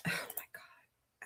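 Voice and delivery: breathy whispered aside; whispering. Real, not acted. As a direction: whisper it to yourself. Muttering to herself at screen.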